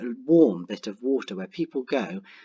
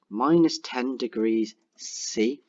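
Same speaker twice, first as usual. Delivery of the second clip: slow dictation; much slower than usual. Shown as a demonstration. Dictating while typing.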